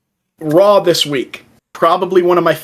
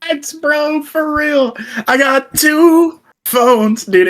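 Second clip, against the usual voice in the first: mock Heyman; 11 semitones higher, slower than usual.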